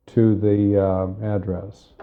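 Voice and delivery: ordinary delivery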